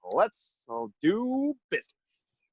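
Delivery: drawn-out, sly "do business"; sly slow deal. Acted. Hobgoblin agreeing to shady work, slow and sly. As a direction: savor the words slowly, scheming.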